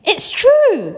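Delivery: high, loud insistent cry; all of them insisting at once. A narrator's reading. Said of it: "cried all four of the old people".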